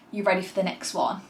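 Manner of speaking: ordinary talk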